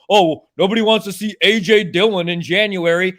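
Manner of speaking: mock-parrot a claim he rejects; mildly exasperated, mocking parroting; scoffing imitation of other people's excuse; mocking quote. Mocks claim, then rebuts it.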